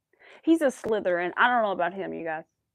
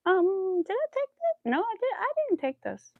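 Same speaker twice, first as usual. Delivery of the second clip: high confused self-quote; 11 semitones higher than usual. Retold or imitated. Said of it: "I was like".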